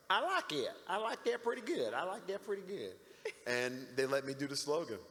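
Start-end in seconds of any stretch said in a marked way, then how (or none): 0.1-2.9 imitating the Southern boss liking it; Southern accent. Southern boss approving the slogan, high Southern voice. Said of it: "He was super Southern".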